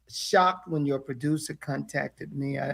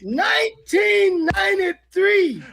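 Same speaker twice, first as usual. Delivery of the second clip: years of friendship poured into a shout; emotional shout; 10 semitones higher than usual. Real, not acted. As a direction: proclaim it with overflowing feeling. Emotional reunion speech.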